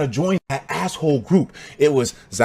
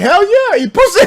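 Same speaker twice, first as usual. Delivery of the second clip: much higher, loud proud answer; proud yell; 6 dB louder than usual. Retold or imitated. Me answering the bully proudly, high loud shout. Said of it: "And I was like".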